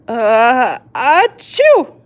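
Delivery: loud high sneeze; a sudden sneeze; big sneeze. A narrator's reading.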